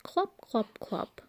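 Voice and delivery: high clip-clop; horse hoof clops. A narrator's reading. Reading aloud, clopping like horse feet. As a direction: click out the hooves. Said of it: "He can go like horse feet".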